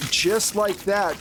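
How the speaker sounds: normal talking voice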